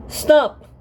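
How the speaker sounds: high shouted scream. A narrator's reading. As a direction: scream to halt the fight. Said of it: "She saw his face and screamed".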